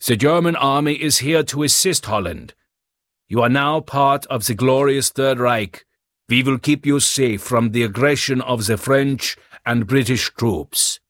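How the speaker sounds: put-on radio announcer with heavy German accent; heavy German accent, official broadcast tone. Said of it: "a heavy German accent declared".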